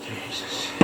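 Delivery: breathy whispered Jesus; whispered exhale. Real, not acted. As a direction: sigh it out under breath. Exhausted after long rock removal.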